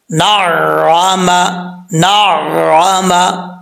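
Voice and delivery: نعمہ chanted very slowly with the doubled sound held; slow tajweed recitation of نعمہ; correct recitation. Shown as a demonstration. Teacher to students, modelling the word, slow chanted نعمہ. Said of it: "تو اس کو کیسے پڑھیں گے؟".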